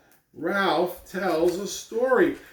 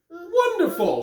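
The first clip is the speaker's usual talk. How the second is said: high, lightly sung praise; a hint of an encouraging teacher; lightly voiced teacher; 10 semitones higher, slower than usual. Teacher praises Ralph's idea.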